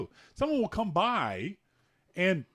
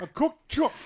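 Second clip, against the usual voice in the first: higher, mock Australian echo; mimicked Australian; 7 semitones higher, 4 dB louder than usual. Husband echoing wife's Australian slang, mock Aussie accent. Parroting her Australian word back.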